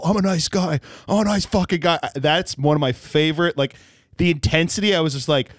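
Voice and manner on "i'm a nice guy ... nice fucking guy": intense nice guy. Retold or imitated. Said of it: "he did the whole".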